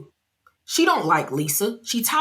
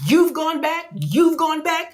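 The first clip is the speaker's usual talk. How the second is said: mock accusing; 10 semitones higher than usual. Angie accusing.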